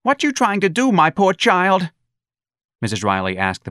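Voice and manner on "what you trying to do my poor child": high, loud indignant mother; protective mother. A narrator's reading. Mother confronting the policeman over her child, high and indignant. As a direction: challenge them in a high voice, defending your child.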